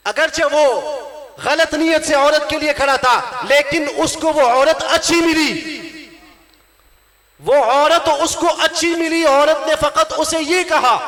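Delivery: impassioned preaching. Real, not acted. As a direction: drive the point home to a congregation. Preaches at full force.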